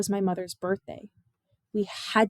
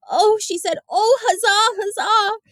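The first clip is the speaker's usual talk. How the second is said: much higher, loud cry of delight; joyful shout; 11 semitones higher, 6 dB louder, slower than usual. A narrator's reading. Girl at the erupting geyser, joyful shout. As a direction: shout huzzah up into the spray. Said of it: "She shouted it into the air".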